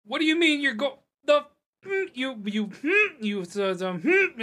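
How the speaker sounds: high, loud sputtering rant; enraged dwarf cook swearing in the back; muffled dwarvish cursing. Acted. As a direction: splutter furious half-words from offstage. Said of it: "In Dwarvish, you hear".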